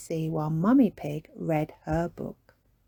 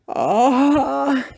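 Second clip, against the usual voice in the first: sleepy drawn-out yawn; 7 semitones higher, 8 dB louder than usual. Acted. Daddy Pig just woken up, yawning. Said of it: "yawned Daddy Pig".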